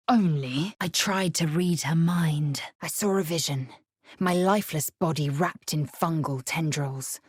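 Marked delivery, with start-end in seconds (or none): none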